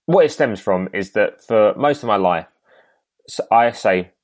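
usual speaking voice

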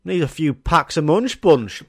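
ordinary talk

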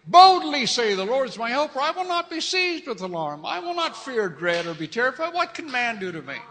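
loud, high, fervent scripture reading; preacher declaring verses loudly; preaching at full force. Acted. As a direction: preach it to the congregation.

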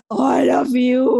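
slow, trembling mock of an angry teacher; a hint of a shaky, fuming teacher; quavering teacher voice. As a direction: drag it out with a shaky edge. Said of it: "Yung may garalgal".

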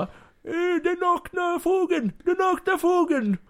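very high, loud mock press mob; squealing caricature of eager journalists; clamouring reporters. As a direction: shriek questions like a pack of reporters. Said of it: "Och alla bara".

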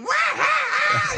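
very high, drawn-out mock guffaw; imitated hard laughter. Imitating friends cracking up in the car, helpless laughter. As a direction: wail out a huge fake laugh. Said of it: "We're laughing so hard".

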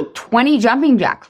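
ordinary talk

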